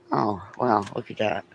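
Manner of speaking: ordinary talk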